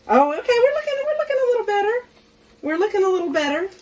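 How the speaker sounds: high, fast, squeaky with nerves; psyching herself up, pitch way up; nervous excitement. Real, not acted. Cook bracing herself before the flip, high and fast.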